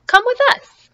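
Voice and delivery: squeaky little duck call; high piping duck. Acted. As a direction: pitch way up like a small duck.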